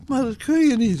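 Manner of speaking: mildly alarmed, high and loud; startled cry. A narrator's reading. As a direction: exclaim in mild shock. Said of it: "riep Marie geschrokken uit".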